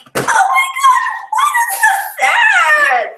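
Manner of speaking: put-on high, slow childish wail; mock wail. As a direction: wail it like a heartbroken seven-year-old. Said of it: "I'm like".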